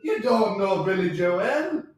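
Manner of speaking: slow, high Irish lilt, rising at the end; incredulous amateur Irish accent. Acted. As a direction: draw it out in a put-on Irish accent. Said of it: "that good at an Irish accent".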